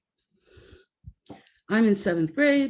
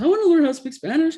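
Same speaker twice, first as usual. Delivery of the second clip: higher, louder, fast, eager; eager kids; 8 semitones higher, 6 dB louder, much faster than usual. Retold or imitated. Class begging the teacher to learn Spanish, eager and fast. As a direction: blurt it out like keen kids. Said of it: "the rest of the class is like".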